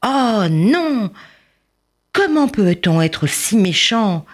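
high, slow, hurt plea close to tears; plead, voice about to break; trembling voice. A narrator's reading. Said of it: "sa voix s-, commençait à trembler".

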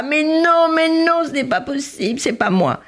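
high, storming mock howl; raging denial. A narrator's reading. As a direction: wail and rage in denial. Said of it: "cria, gémit, rugit, tempêta".

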